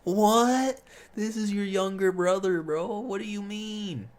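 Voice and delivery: high, incredulous "What?"; can't believe what you heard; stunned disbelief. Real, not acted. Disbelief at brother's indifference.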